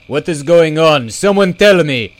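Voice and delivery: loud, high panicked cry. A narrator's reading. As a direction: cry out in confusion. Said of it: "Hugo cried".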